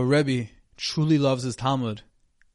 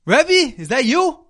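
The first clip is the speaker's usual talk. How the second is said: much higher, loud startled cry; shocked scream; 13 semitones higher, 10 dB louder, much slower than usual. Acted. Yossi spots his rabbi in the theater, shocked scream. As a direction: shriek it in disbelief. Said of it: "he screamed".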